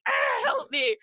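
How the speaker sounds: high, shouted cry for help; mock panicked plea. Leo bursting into brunch crying, high mock panic. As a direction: wail it like a desperate kid.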